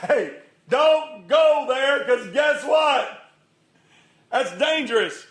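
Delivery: loud, high, drawn-out warning; shouted warning. Acted. Preacher warning friends away from a fall, urgent shout. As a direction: holler it slowly to stop a friend.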